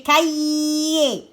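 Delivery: "cahier" stretched slowly through its middle; sounding out. Shown as a demonstration. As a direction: draw out "cahier" so each sound can be checked. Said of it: "j'entends le R?". (« cahier » étiré lentement pour entendre chaque son)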